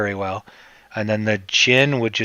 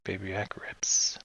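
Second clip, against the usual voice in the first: whispering the last words of the jingle; whispered jingle; 3 semitones lower, 14 dB quieter than usual. Pretend. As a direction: breathe the ending out as a whisper. Jingle line ends in a whisper.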